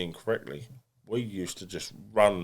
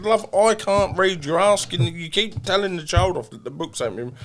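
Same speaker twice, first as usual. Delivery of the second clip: retold complaint; 10 semitones higher, 8 dB louder than usual. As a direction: let rip at the teacher, indignant. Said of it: "Like, I said".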